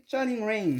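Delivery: English card-set name in a French accent. Real, not acted. Unboxer attempting an English set name for viewers, French accent. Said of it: "Excusez mon accent anglais".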